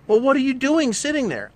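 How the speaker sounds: hammed-up nagging wife; mock ex-wife scolding him for sitting. Ex nagging, acted quarrel.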